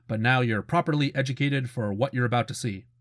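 usual speaking voice

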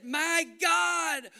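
a pleading shout of abandonment; pleading cry. Acted. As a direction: cry it up to heaven. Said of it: "He pleaded and he said".

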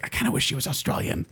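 whispered inner thought, retold; hushed aside. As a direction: say it under your breath, like a private wish. Retells a private wistful thought.

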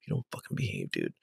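low, harsh whispered yell. Retold or imitated. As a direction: yell it under your breath. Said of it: "angrily, like, whisper yells".

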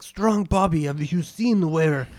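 high, pushed brute voice with his own name; a big dumb warrior calling out; dim-witted brute. Acted. Strong Bobby asks the party about his axe, dopey. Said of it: "he's like".

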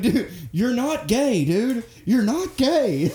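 high, drawn-out protest, acting out a movie line; mock pleading insistence. Retelling a movie scene to friends, mock insistence. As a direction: stretch the key word high, as if correcting a buddy.

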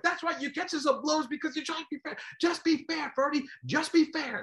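bully the commentator; Don King yelling. Retold or imitated. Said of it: "That's Don King yelling at him".

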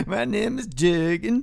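slow, put-on German voice; fake German accent. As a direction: play a hammy German named Jürgen. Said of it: "I'm from Sweden".

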